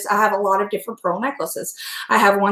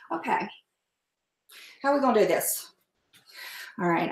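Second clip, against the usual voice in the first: ordinary talk, nothing marked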